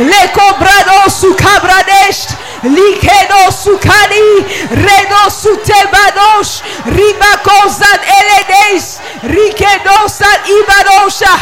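loud, slow ecstatic tongues; praying in tongues. Real, not acted. Pastor praying in tongues over the congregation, loud and fervent. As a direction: pour out tongues at full force.

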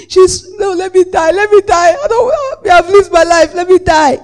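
loud, high hammed-up wailing plea; mock crying. Acting out a wailer at the deathbed, mock crying. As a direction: wail like an overdone mourner.

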